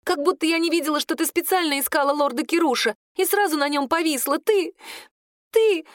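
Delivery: high, wounded accusation; indignant, near tears. A narrator's reading. Claudine accuses the witch of chasing Kirush, high and upset. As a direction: build to a high, choked-off accusation. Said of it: "У неё из глаз брызнули слёзы".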